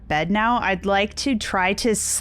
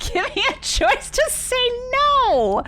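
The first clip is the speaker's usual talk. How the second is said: laughing, much higher and slower; laughing complaint; 13 semitones higher than usual. Real, not acted. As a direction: protest through a laugh. Laughing at the game's choices.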